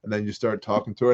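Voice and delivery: ordinary talk, nothing marked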